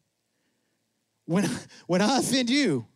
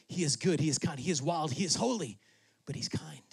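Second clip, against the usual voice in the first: softer, lower, tender; 4 semitones lower, 7 dB quieter than usual. Real, not acted. Preacher to congregation about God's kindness, softer and lower. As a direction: let it sink in gently.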